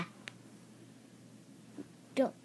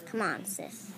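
ordinary talk

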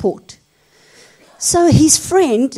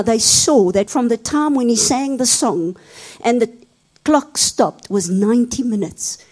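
usual speaking voice

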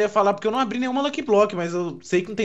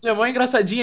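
normal talking voice

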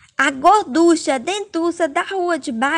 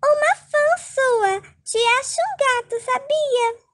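flirty fan voice; 7 semitones higher than usual. Acted. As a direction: coo his name like a smitten fan.

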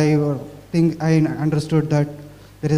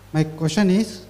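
ordinary delivery

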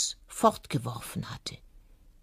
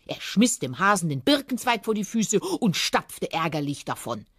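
ordinary delivery

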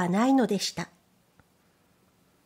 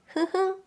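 high, soft ふんふん hum-laugh; a contented little bear chuckle; happy little chuckle; 8 semitones higher, 3 dB quieter than usual. A narrator's reading. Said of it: "と笑いました".

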